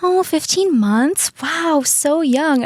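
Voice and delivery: high, slow, gushing neighbor voice; neighbor misunderstanding. Retold or imitated. Neighbor gushing over a puppy, slow high coo. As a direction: coo it slowly like a sweet clueless neighbor. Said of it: "She's like".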